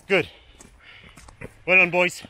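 shouted well done; cheering on finishing marchers; loud praise. Real, not acted. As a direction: call the praise out to them.

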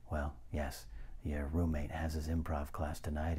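ordinary delivery

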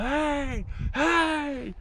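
high, drawn-out mock holler; long high-pitched call, held back; imitated hollering. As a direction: mimic a cousin hollering, without full volume. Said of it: "he's hollering like".

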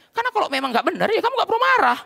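loud, high rebuke from the pulpit; full-force preaching. Real, not acted. Pastor challenging churchgoers angered by the sermon, forceful preaching. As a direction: raise voice and pitch to drive it home.

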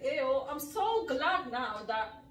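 ordinary talk

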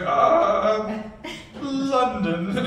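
high put-on English accent, hesitant. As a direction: drag out London in a mock English accent. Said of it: "she did an English accent".

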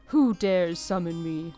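slow menacing demon voice. Acted. Reads the summoned demon's line.

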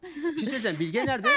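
usual speaking voice

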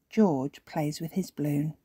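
usual speaking voice

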